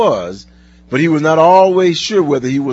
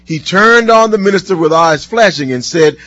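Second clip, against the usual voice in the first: usual speaking voice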